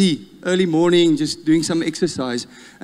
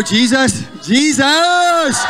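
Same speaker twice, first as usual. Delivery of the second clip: rising full-voiced shout of Jesus, higher and louder; a whole church crying out the name together; 10 semitones higher, 5 dB louder, much slower than usual. Real, not acted. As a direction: cry out the name with everything. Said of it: "can we just scream out, "Jesus".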